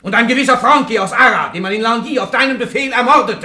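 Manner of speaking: raging judge confronting the prisoner; furious accusation. Acted. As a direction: rattle off the charge in a rage. Judge charges her with murder.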